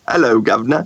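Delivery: lightly attempted Cockney greeting. Shown as a demonstration. Guest mimicking on request after protesting, light Cockney. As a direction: have a reluctant go at a Cockney accent. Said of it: "That was a stereotypical Cockney accent".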